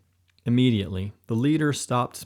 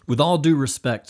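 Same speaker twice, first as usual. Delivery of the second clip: blurted out; 5 semitones higher, 6 dB louder than usual. A narrator's reading. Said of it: "as he blurted out".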